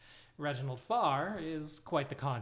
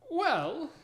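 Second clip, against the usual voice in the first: higher, louder, hesitant opener; older halfling voice; 14 semitones higher, 6 dB louder than usual. Acted. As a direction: start timidly, pitched up. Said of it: "he says".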